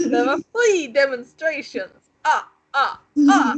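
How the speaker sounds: mock Count von Count from Sesame Street, German-ish vampire counting. Mocks reader as Count.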